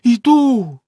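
loud, high cry of despair; wife answering in despair. A narrator's reading. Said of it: "розпачливо сказала".

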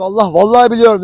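loud, pleading protest; loud desperate swear to the officer; insistent protest. Retold or imitated. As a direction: swear you really know it.

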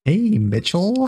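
mock game character. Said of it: "echoes the line".

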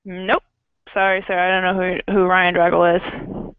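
usual speaking voice

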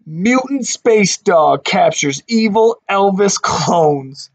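slow, punchy headline reading; newspaper headline voice. Shown as a demonstration. Reading the newspaper headlines to kids, slow headline announcer. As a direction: announce each headline like a newsboy. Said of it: "It says".

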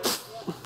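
unvoiced puff like a flick of lightning; mock zap noise. Preacher mimics God's lightning bolt, soft pfft. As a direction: blow out a quiet pfft. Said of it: "he went".

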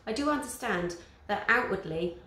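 usual speaking voice

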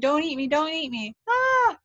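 high-pitched panicked plea rising to a shriek; frightened scream. A narrator's reading. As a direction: plead in terror, then let out a high scream. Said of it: "I screamed".

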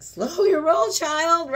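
hammed-up loving scold; God's correction. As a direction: rein her in like a sassy elder. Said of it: "God's like".

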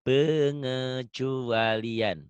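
Pengecualian spelled out very slowly, syllable by syllable; slow dictation. Shown as a demonstration. A teacher dictating Pengecualian very slowly to his student. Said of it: "Ini pengecualian ya".

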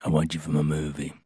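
low, soft gravelly movie-star voice; Vin Diesel voice. Retold or imitated. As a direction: gravelly growl into the phone. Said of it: "you heard his gravelly voice".

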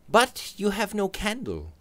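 Robert voice. Acted. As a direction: fuss over him.

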